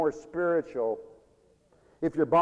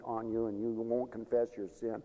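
usual speaking voice

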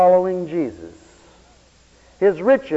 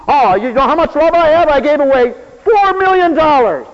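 a louder, higher showy brag; mock boastful giver; 7 semitones higher, 6 dB louder than usual. Preacher mocking a proud giver, boastful. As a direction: show off your big gift, hammed up.

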